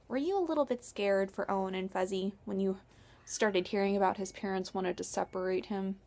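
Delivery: usual speaking voice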